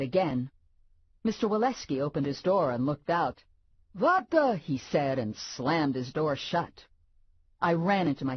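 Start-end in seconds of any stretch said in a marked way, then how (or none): 3.9-4.6 sudden high startled gasp of words. Acted. Startled neighbor at his door, high surprised blurt. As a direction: neighbor caught off guard, jumping up high.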